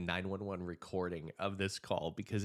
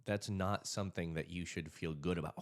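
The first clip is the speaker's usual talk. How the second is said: usual speaking voice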